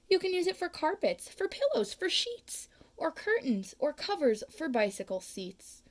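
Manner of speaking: pushy salesman rattling off uses; eager sales pitch. Acted. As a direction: sell it hard, brightly climbing. Onceler's salesman pitch for thneed.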